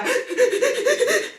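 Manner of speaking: high, staccato mock sobs; re-enacted bawling that tips into laughter; high hammed-up crying noise; imitated crying. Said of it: "that's how I was crying".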